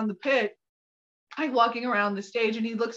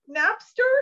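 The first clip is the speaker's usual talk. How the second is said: NAPSTER high, lifting into a question; upward inflection; 9 semitones higher, 3 dB louder than usual. Shown as a demonstration. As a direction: say "Napster?" with a rising, questioning end. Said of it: "with an upward inflection".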